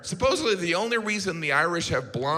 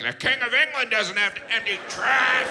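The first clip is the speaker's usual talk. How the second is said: whiny ex-king; 7 semitones higher than usual. Retold or imitated. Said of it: "he's like".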